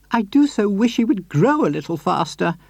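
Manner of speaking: put-on high, sweet old lady voice; Mrs Silver voice. A narrator's reading. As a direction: lift into a gentle, doting lady.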